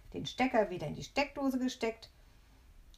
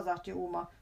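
ordinary delivery, nothing marked

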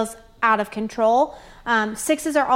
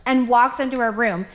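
usual speaking voice

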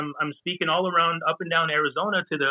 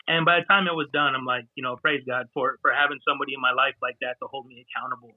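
ordinary talk, nothing marked

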